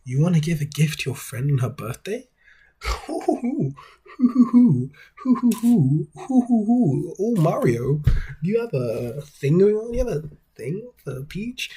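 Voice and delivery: high, teasing cartoon voice with hooting giggles; hammed-up Luigi. Luigi teasing Mario about Peach, hammed-up high hooting voice. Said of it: "And then Luigi goes".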